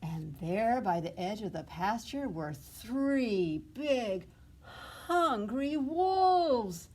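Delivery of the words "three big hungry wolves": dramatic reveal. A narrator's reading. Storyteller builds dread.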